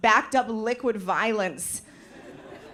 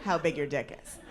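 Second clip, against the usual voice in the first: lower, soft, laughing scheming voice; sly subconscious; 5 semitones lower, 6 dB quieter than usual. Retold or imitated. Her subconscious scheming, low soft sly voice. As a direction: plot it under your breath. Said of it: "my subconscious was like".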